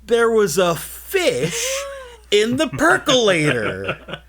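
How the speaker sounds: put-on high, loud, slow old-man reveal. Old guy tells them about the fish, slow high voice. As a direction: draw out the line like a fussy old man. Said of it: "And he's like".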